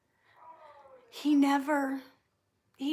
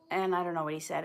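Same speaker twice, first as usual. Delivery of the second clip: ordinary talk, nothing marked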